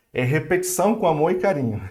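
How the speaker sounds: ordinary talk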